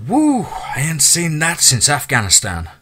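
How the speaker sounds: awed whoop. Acted. Soldier watching a distant blast, awed whoop. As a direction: let the wonder of the explosion out.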